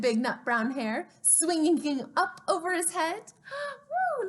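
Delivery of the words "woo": high gasping woo; surprised gasp. Real, not acted. Librarian marvels at the picture for children, gasping.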